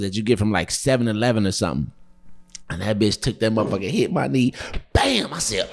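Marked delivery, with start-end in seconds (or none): 4.9-5.3 high, sudden bam; imitated impact. Storyteller recreating a mug hitting his knee, sudden high exclamation. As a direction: punch out the sound of a hit.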